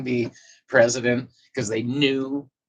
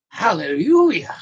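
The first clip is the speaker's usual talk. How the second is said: mock hallelujah; 9 semitones higher, 8 dB louder than usual. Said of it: "Sandy, I was like".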